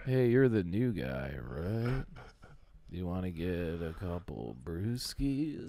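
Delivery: low, soft, slow creepy-friendly pickup line; full Dahmer. Pretend. Joking about chatting up the woman, low, slow creepy charm. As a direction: lure her in gently and a little slow. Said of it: "you go full Dahmer".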